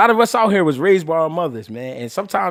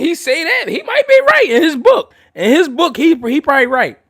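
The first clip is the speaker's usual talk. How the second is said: laughing; 11 semitones higher, 9 dB louder than usual. Real, not acted. Laughing at the old head's claim.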